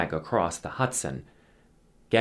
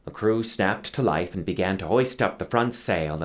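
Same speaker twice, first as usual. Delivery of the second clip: usual speaking voice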